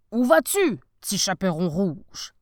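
loud, rough big bad wolf; gruff wolf voice. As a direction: growl it like a hungry wolf. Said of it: "une grosse voix rauque".

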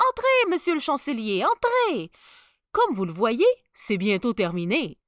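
sly tailor ushering him in; fawning tailor. Acted. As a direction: greet him with oily charm. Tailor welcomes chancellor.

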